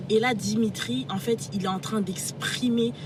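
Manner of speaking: usual speaking voice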